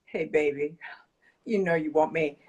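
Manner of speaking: low, sultry come-on; seductive cake voice. Pretend. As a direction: tempt her like a lover in the dark. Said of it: "then it puts on its seductive voice".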